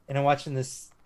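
ordinary talk, nothing marked

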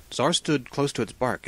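plain reading voice

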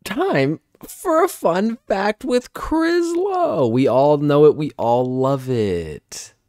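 drawn-out, ringing segment announcement; showy announcer. Acted. Host launching the fun fact segment, showy drawn-out announcer. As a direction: announce it big, stretching the words.

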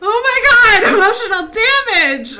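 high, very loud outburst; shocked yell. Real, not acted. Player reacting to a mishap, shocked yell.

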